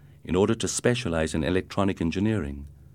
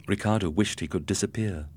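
ordinary delivery

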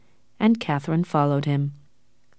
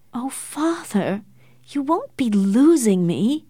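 high, drawn-out plea; very upset; 6 semitones higher, slower than usual. A narrator's reading. Daughter reassuring father she won't be lost, very upset. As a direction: plead slowly with pitch raised. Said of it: "very upset".

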